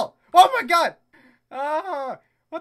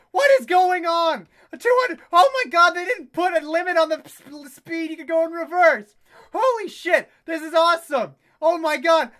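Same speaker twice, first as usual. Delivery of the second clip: loud, high, giddy exclaiming; overexcited gamer at full volume; amazed excitement; 5 semitones higher, 8 dB louder than usual. Real, not acted. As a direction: shout it in thrilled disbelief. Amazed at runaway reverse speed.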